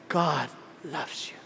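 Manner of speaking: very low, soft, smooth delivery; suave preacher impression. Handsome pastor preaching to his audience, low and suave. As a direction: drop right down and croon it quietly. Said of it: "Pag ganyan, pag preach".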